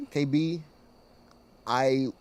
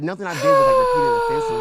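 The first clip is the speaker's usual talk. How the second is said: defensive outburst, climbing up and louder; rising protest; 10 dB louder than usual. Real, not acted. Husband protests his wife's accusation, voice cracking high and loud.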